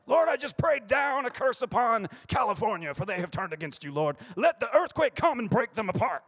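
high, loud, hammed-up curse prayer; mock fire-and-brimstone prayer. Pastor mocking a vengeful prayer: loud, high, hammed-up. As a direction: call down doom like a ranting zealot, half laughing.

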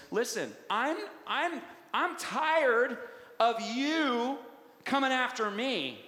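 emphatic fed-up challenge to the devil; defiant declaration. Acted. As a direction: draw it out like you are done being pushed.

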